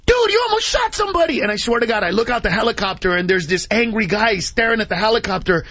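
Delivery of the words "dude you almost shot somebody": much higher, startled quoted scolding; alarmed soldier. Retold or imitated. Soldier scolding Carlos after the cannon burst, high alarmed voice. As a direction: squeak it out in disbelief.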